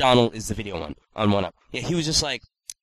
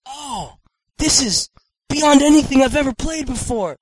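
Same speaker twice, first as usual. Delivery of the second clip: much higher, shouted raving; over-the-top gushing; 12 semitones higher, 6 dB louder than usual. Retold or imitated. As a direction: gush like a superfan. Mock gushing over game.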